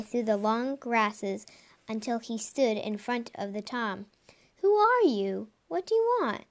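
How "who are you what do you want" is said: put-on high-pitched young cat demanding answers; much higher voice for a startled youngster; startled questioning. A narrator's reading. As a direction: raise the pitch sharply, puzzled and urgent.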